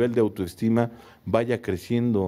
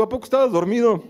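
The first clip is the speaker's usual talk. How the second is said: mock teasing; 9 semitones higher, 4 dB louder, faster than usual. Teasing a friend woken early.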